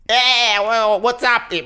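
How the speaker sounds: high, loud hammed-up YouTuber greeting; mock a try-hard internet vlogger; loud, high, mocking YouTuber intro. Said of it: "jak widzisz tych, kurwa, wrzoskunów internetowych".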